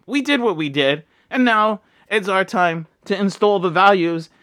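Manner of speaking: real emotion, voice lifted high; worked up. Real, not acted. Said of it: "getting choked up and worked up".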